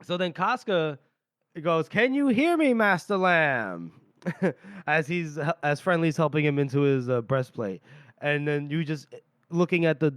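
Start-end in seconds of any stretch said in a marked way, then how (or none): 1.9-3.9 high opening, drawn-out last words; retold hail to someone inside a fort; calling out. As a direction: call into a building, stretching the name.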